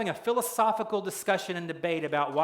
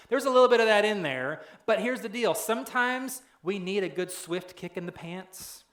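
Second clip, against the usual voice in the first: ordinary delivery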